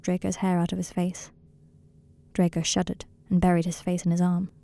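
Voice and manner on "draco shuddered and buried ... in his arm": plain reading voice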